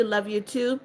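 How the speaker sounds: usual speaking voice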